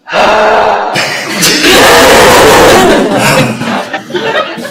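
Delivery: a big, loud sharp gasp, demo for the class; loud demonstration gasp. Shown as a demonstration. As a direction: gasp in loudly, showing how it's done. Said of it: "I want you to go like this".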